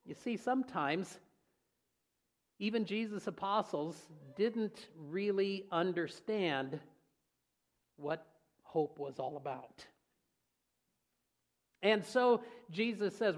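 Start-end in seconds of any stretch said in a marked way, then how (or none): none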